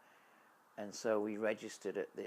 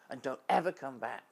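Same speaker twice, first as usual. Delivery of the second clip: louder, higher furious order; angry shout; 5 semitones higher, 7 dB louder than usual. Retold or imitated. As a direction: bark the final warning loudly. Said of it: "really furious and angry".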